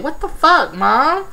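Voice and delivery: high-pitched mock indignation; disrespectful daughter. Daughter cursing at her mom, imitated disrespect. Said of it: "showed us just how disrespectful she was".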